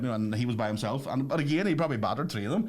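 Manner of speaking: normal talking voice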